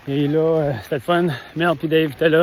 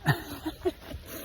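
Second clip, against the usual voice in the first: a high surprised giggle; high laugh; 12 semitones higher, 10 dB quieter than usual. Real, not acted. Laughing at own anecdote.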